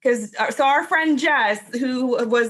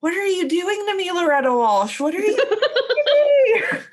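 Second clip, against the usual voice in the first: laughing lament; 8 semitones higher than usual. Retold or imitated. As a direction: playfully accuse the actress through laughter. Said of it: "I was like".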